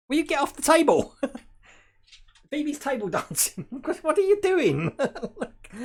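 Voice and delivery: loud, high exclamations breaking into laughter; laughing scolding. Real, not acted. Owner to dog dancing on the table, laughing outcry. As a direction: scold the dog while cracking up.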